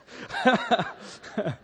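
amused laugh at own story. Real, not acted. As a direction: chuckle after the punchline.

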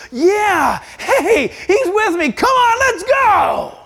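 high, loud, whooping pep talk; rally everyone, building to a drawn-out shout; exhorting rally cry. Acted. Believer rousing the church to follow God, exhorting. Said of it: "it was an exhorting word".

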